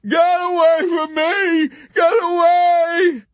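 high, drawn-out wailing shout; tearful scream. A narrator's reading. Yossarian punching Arfi, tearful scream. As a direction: wail it at full volume. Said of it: "broke into tears".

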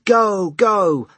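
high, loud urgent yell. A narrator's reading. Jess urging the driver through the streets, yelling. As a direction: yell like driving on a horse. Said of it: "Jess yelling".

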